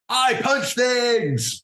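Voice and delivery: high, drawn-out triumphant shout; heroic yell. Acted. Hero stands on the slain boar, triumphant yell. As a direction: yell it as a proud hero's boast. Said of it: "And yells".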